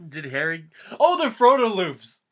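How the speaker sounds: normal talking voice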